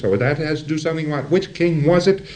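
acted authoritative interrogator. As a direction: press him briskly with authority. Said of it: "in an authoritative voice".

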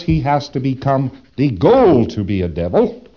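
ordinary delivery

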